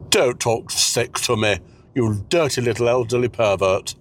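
ordinary talk

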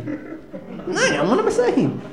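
high squeaky laugh through the words; laughing at himself. Real, not acted. Comic to the crowd, laughing at his own blunder. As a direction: crack up mid-sentence at your own slip.

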